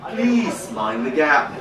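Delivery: slow, sultry British purr; mock-seductive British lover, drawn out slowly; sexy British voice. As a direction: whisper it slowly like a seductive Englishwoman. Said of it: "she can tell me in her sexy voice".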